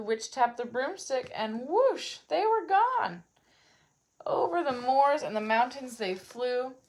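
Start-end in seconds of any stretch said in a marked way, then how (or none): none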